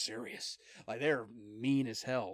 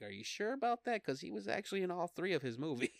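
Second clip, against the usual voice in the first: higher, mocking disbelief; skeptical, laughing challenge; sarcastic doubt; 7 semitones higher, faster than usual. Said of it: "I'm like".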